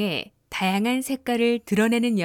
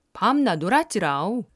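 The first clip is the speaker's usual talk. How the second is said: tender Jeolla accent ending on 라오; Jeolla dialect. Shown as a demonstration. Narrator demonstrating Jeolla endings to learners, tender Jeolla accent. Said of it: "요 대신 라오를 붙여 부드럽고".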